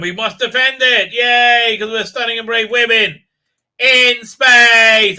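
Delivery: high, loud sarcastic cheering; mock heroic. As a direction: crow it like a ridiculous hero. Mocking the heroines' rallying speech.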